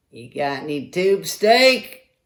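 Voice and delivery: high, loud, drawn-out holler; hollered question. Acted. Movie character shouting to guys in the meat wagon, hollered. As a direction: call it out high and stretched. Acting out a crude movie scene.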